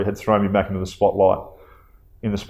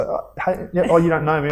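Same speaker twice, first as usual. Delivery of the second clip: higher, eager fan greeting; imitating a stranger; 8 semitones higher than usual. Fan in the street greeting the hero, high and eager. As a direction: mimic the stranger walking up. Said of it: "and you just think".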